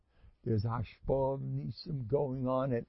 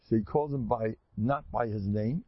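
usual speaking voice

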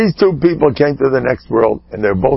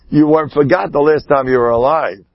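normal talking voice

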